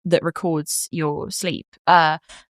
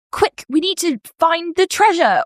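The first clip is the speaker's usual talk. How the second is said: higher, louder, slow urgent call; urgent adventure-quest voice; a dream character calling on a quest; 10 semitones higher, 5 dB louder than usual. Retold or imitated. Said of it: "dream characters will come in and be like".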